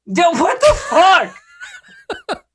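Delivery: high, loud, drawn-out disbelief; real frustrated yelp; exasperated outburst. Real, not acted. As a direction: cry out at rotten luck. Exasperated after a failed roll.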